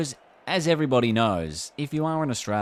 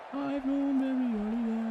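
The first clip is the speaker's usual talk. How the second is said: silly high voice. Pretend. Repeats own line in silly voice.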